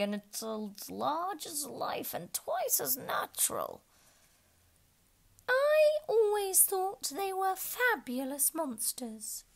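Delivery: high, slow character voice; slow, high-pitched storybook voice; voiced character. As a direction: read it as an eager storybook creature. Haya and unicorn voiced in story.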